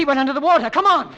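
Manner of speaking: urgent. Acted. Urgent pursuit.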